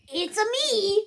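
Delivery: light Italian plumber impression; chirpy "It's a me" Mario bit. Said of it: "I'm gonna turn on my Mario voice changer".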